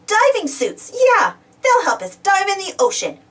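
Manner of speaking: usual speaking voice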